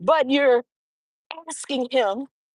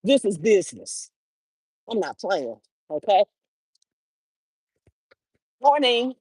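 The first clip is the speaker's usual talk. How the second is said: firm business tone; 4 semitones lower than usual. Shown as a demonstration. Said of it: "our tone of voice needs to say".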